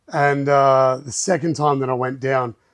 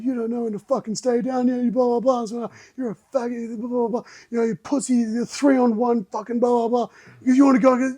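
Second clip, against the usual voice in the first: mock aggressive taunt; 9 semitones higher than usual. Aggressor taunting bloodied speaker.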